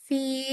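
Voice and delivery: فی with the h sound held before it ends; sustained fi. Shown as a demonstration. Teacher shows students the held h in فی. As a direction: hold the ہ sound in فی. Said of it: "ہاں کی نا جو آواز ہے ... continue رہ رہی ہے".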